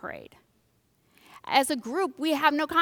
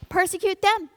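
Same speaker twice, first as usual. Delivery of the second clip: higher, hammed-up group voice; mock Christians; 8 semitones higher, 4 dB louder than usual. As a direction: sneer as a petty crowd. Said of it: "the Christians are over here like".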